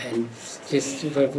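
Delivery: usual speaking voice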